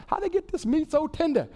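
goofy Shrek impression, pitched high. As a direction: wonder aloud like Shrek. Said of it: "I always go to the Shrek voice".

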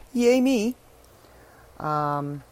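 ordinary talk, nothing marked

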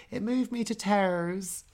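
slow Norfolk lilt; Norfolk accent. Shown as a demonstration. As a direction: stretch it out in broad Norfolk. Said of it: "it comes as, as, like, tears".

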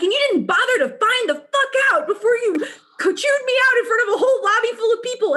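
high, fast, loud retold rant; reliving her angry teen outburst at the boss. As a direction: unload on the manager, quick and heated. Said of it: "I was so angry".

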